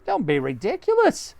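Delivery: monkey pleased with the tree house; Mugglewump. Acted. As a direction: ask it innocently. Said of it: "Mugglewump said".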